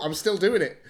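ordinary talk

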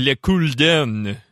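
put-on slow hammy French accent; mock French accent, drawn out. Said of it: "Impeccable French accent".

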